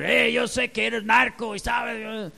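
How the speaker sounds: shouted high and rough, an aggressive confrontation; mock yell. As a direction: bark it at the neighbour like an accusation. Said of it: "No tengo que gritarle y decir".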